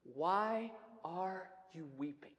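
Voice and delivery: very soft, slow, low question; angels' gentle question. Acted. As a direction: ask it hushed and unhurried, each word apart. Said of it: "One from the lips of angels".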